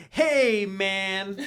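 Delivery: a dopey stoner brushing it off; nervous dude. Acted. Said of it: "Marshall just goes like".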